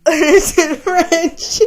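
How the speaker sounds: laughing it out slowly in disbelief; cracking up at the French ad; words caught in a laugh. Real, not acted.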